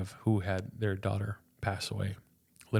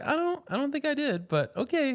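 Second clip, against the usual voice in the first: much higher, airy doubtful nurse; high-pitched lady voice. Retold or imitated. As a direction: pitch it way up, unsure but polite. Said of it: "She's like".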